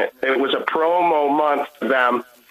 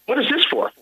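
puzzled surprise; 11 semitones higher than usual. Retold or imitated. Surprised clerk questions the gift.

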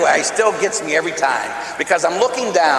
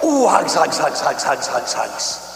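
fading to a whisper; tender hug sounds; slower than usual. Retold or imitated. Hugging niece tightly.

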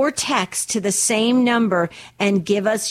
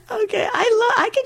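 much higher, cracking with laughter; laughing, pitched up; 13 semitones higher than usual. Real, not acted. Host still laughing after her pun, high. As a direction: squeak it out mid-laugh. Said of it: "laugh all day long".

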